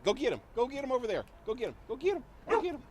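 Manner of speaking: usual speaking voice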